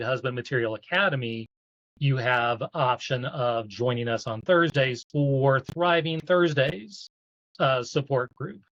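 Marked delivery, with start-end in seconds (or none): none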